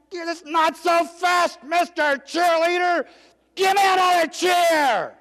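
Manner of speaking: high, loud, slow commanding shout; admiral's shout. Retold or imitated. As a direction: stop him at the door with a bellow. Admiral barks at leaving midshipman.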